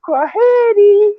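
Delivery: "Kwaheri" said slowly and high, each syllable drawn out; Swahili word. Shown as a demonstration. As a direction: sing out "Kwaheri" high and slow. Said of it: "We say".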